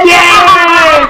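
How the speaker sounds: high, loud laughing "yes"; real laughing whoop of approval; delighted shout. Real, not acted. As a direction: cheer the right answer through laughter. Teacher cheers correct answer, laughing.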